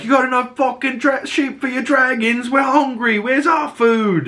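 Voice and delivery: high, mock-indignant peasant voice, slowing at the end; hammed-up angry townsfolk; angry townspeople. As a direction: play the hungry crowd demanding food. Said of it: "the townspeople are like".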